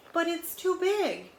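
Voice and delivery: a tiny mouse protesting the sweater is too big; high complaining mouse. A narrator's reading. Reading to a child, the mouse whines in a high voice.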